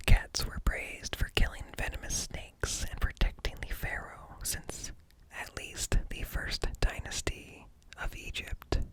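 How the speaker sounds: ordinary talk, nothing marked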